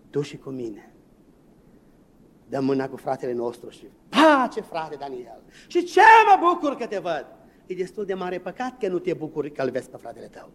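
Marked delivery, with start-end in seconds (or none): 4.1-7.3 gushing, overdone brotherly welcome, much higher and louder; fake cheerful greeting. Preacher mocking a fake warm greeting to a brother. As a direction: ham up a fake joyful hello.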